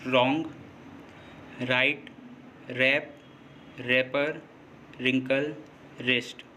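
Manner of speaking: रॉन्ग, राइट, रैप, रैपर, रिंकल, रिस्ट each starting on a plain R; silent W. Shown as a demonstration. Teacher listing examples of W before R, silent W. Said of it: "तब भी डब्ल्यू silent होता है".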